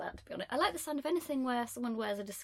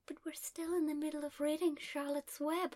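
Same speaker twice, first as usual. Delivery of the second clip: higher-pitched little girl impression; little girl's plea; 6 semitones higher, 3 dB quieter than usual. Little girl to departing Robin Williams, higher pleading child voice. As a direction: plead like a small child in a film. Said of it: "and she goes".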